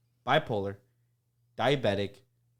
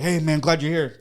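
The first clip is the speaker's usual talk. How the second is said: louder, higher friendly hail; hearty mock greeting; 5 semitones higher, 9 dB louder than usual. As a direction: greet them like a coworker calling out. Acted coworker greeting.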